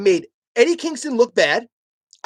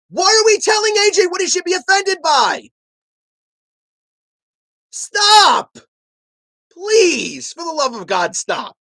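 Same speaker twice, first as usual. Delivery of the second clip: exasperated shout; 7 semitones higher, slower than usual. Real, not acted. Pleading with chat to stop.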